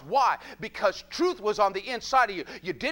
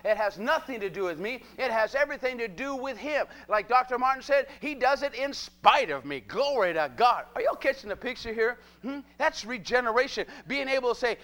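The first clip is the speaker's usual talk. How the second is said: normal talking voice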